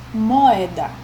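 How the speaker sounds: moeda with a small break between mo and eda; pause in moeda. Shown as a demonstration. Teacher showing class the hiatus, pause in moeda. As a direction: let the O and E of moeda sound apart. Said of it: "Note que há esse espaçamento".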